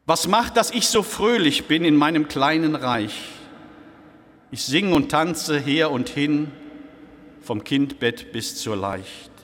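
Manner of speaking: rising questions, then settling answers; poem recitation. Acted. Preacher recites the poem's middle verses to his flock, measured and bright. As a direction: read each verse like a gentle joyful riddle.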